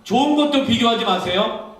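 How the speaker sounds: very loud, high climax; preaching shout. Real, not acted. Preacher capping a list of don'ts, preaching shout. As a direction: cry out the command to the congregation.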